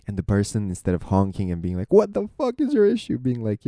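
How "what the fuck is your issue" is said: high, loud furious yell at another driver; angry driver yell. Retold or imitated. Driver nearly hit yells at the other driver, furious shout. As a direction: blast it like a road-raging driver.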